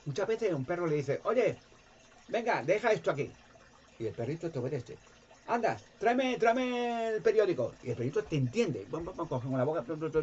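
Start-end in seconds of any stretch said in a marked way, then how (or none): 1.2-3.4 raised, bossy dog-owner call. Retold or imitated. Owner calling his dog, commanding. 5.5-7.8 much higher, coaxing command to a dog; ordering the dog. Retold or imitated. Owner ordering his dog to fetch, coaxing.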